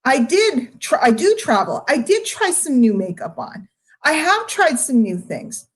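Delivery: ordinary delivery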